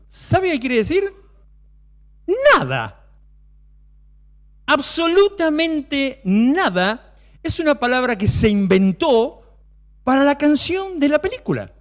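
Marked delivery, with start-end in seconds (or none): 4.6-7.1 Absolutamente drawn out slow and rising; stress on Absolutamente. Real, not acted. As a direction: stretch Absolutamente, then nada. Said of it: "emphatic answer".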